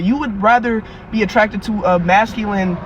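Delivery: ordinary talk, nothing marked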